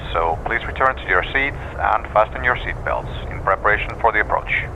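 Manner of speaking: pilot cockpit headset. Acted. Captain's cabin announcement.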